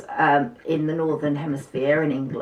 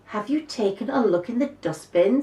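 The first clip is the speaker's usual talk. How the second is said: put-on girl asking helpfully; 6 semitones higher than usual. Arlene voiced in story.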